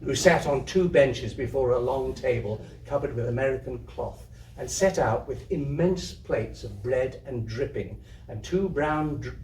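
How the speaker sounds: usual speaking voice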